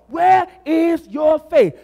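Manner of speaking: slow, loud, high challenge; shouted, drawn-out question; preaching shout. Acted. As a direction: hammer the question home to the church.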